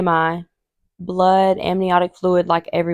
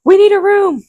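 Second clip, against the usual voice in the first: much higher, hurried call; urgent staff; 13 semitones higher, 5 dB louder than usual. Retold or imitated. Labour staff calling out, urgent high mock voice. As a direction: nurses calling for a room, pitched way up. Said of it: "they're like".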